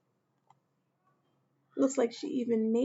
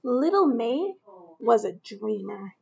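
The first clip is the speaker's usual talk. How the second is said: expressive storybook narration, louder; reading to children; 5 dB louder than usual. A narrator's reading. Teacher reading a picture book aloud to children. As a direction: read it out to a young class.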